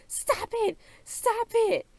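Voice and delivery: very high, squealed imitation scream; mock scream. Imitating Ariana screaming at the quarrel, mock scream. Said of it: "Ariana again screaming".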